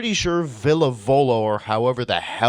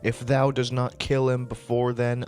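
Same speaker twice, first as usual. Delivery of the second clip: ordinary delivery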